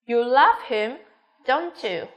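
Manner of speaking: "him" up, pause, "don't you" down; falling tag question. Shown as a demonstration. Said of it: "đều xuống giọng của câu hết".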